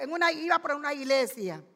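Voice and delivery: plain reading voice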